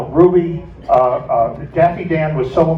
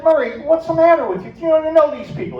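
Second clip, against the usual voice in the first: exasperated scolding; 12 semitones higher, faster than usual. Retold or imitated. Narrator scolds Murray at party.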